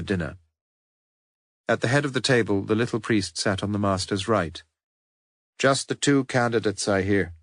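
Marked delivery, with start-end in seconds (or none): none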